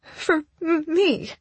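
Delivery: high, halting, tearful stammer; voice breaking. A narrator's reading. Crying woman amazed he did it for her, voice breaking. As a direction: choke on the words, breaking up. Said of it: "my voice breaking".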